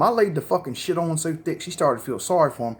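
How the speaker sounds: normal talking voice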